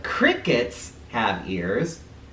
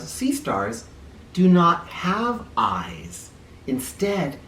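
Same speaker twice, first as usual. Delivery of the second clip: usual speaking voice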